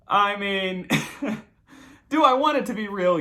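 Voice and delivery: high, loud, laughing question. Real, not acted. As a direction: talk through leftover laughter. Still laughing at the email.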